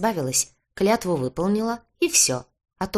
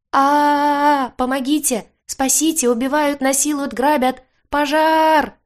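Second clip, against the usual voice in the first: high, loud screams rising toward ultrasound; frantic high-pitched yelling; shrieking for help; 10 semitones higher, 7 dB louder, slower than usual. A narrator's reading. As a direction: scream the alarm, each cry piercing. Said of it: "заорала я, переходя в ультразвук".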